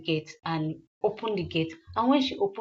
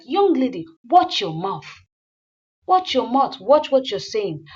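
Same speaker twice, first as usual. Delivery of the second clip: loud, scolding warning; stern rebuke; 4 semitones higher, 6 dB louder than usual. Acted. Older guest scolding the rude young woman, loud and stern. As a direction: put the girl in her place.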